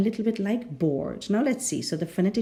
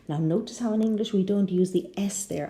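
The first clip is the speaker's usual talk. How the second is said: ordinary talk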